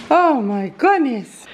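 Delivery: slow amused laugh into oh my goodness. Real, not acted. Mother on the farm laughing at a surprise. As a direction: laugh it off in disbelief.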